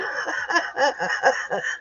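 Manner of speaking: quiet airless laugh; breathless wheeze laugh. Real, not acted. Vlogger wheezing at the cop parade.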